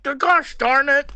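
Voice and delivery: slow, high folksy dad voice; folksy dad grumble. Retold or imitated. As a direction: drag out a corny dad gripe. Dad's mock outrage.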